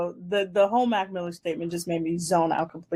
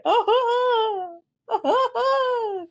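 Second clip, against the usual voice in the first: high, fake sobbing "ah ah"; mock whiny crying; 4 dB louder, much slower than usual. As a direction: whimper it out like a hammed-up crybaby. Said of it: "I just get".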